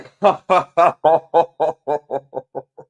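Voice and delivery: loud, high, long peals of laughter; helpless laughter. Real, not acted. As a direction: burst out laughing uncontrollably. Cracking up after the clip.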